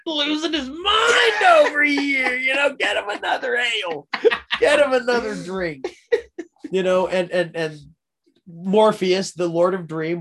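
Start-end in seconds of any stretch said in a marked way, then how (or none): 0.1-5.9 hollering like the tavern crowd fed up with Hobbs; mock tavern crowd yell. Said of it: "everybody's just like".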